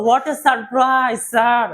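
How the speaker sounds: very slow, every word drawn out; surprised. Acted. Student thanking the teacher for Eid money, drawn out. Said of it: "কি বলবো".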